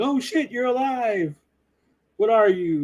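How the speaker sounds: hammed-up shock at seeing him alive; shocked Obi-Wan. Mocking Obi-Wan finding his old pupil alive, high shocked squeal. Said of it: "when Obi-Wan's like".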